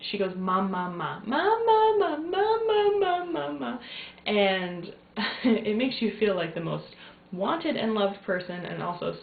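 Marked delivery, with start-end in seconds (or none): 0.4-3.8 put-on baby whine, starting plain and jumping much higher and louder, then easing off; building imitated baby whine that peaks then fades; imitating baby whine. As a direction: mimic a fussy baby whining for mom at bedtime. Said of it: "She whines in a way".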